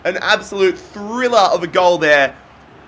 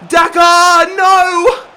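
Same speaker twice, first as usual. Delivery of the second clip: anguished shout; 9 semitones higher, 7 dB louder, much slower than usual. Real, not acted. Chance missed.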